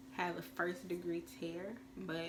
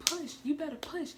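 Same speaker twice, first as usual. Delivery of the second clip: high, louder, pushy nurse urging; mimicking the nurse's tough talk; imitating the tough-talking nurse; 9 semitones higher, 6 dB louder than usual. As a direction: bark encouragement like a tough nurse.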